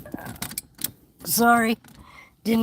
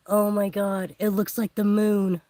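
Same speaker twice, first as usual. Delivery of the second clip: usual speaking voice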